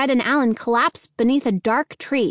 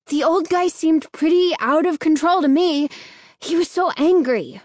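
heated, higher-pitched protest; angry interruption; 6 semitones higher than usual. A narrator's reading. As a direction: argue back with raised pitch. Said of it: "Danny interrupted angrily".

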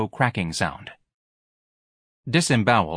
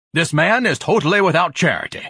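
loud, high declaiming; indignant denunciation; 9 semitones higher, 5 dB louder than usual. Acted. Ignatius denouncing the manager to the battalion, indignant. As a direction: denounce him loudly to all.

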